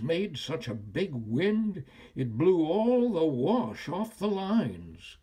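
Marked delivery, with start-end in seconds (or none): none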